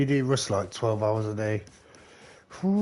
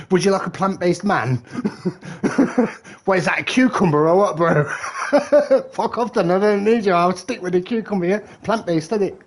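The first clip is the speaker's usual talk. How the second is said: louder, giggling through both parts of a pretend row; laughing mock quarrel; 6 semitones higher, 6 dB louder than usual. Man teasing his missus over plant-based cream, laughing. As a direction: ham up the row while cracking up.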